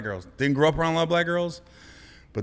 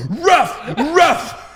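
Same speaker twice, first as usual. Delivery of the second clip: high, loud dog bark; storyteller reenacting bark, loud; 13 semitones higher, 10 dB louder than usual. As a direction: bark it again. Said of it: "He rough, rough".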